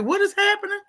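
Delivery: high-pitched incredulous question; what on earth is this; baffled disbelief. Retold or imitated. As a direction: voice jumps up, bewildered. Baffled reaction to parent's odd remarks.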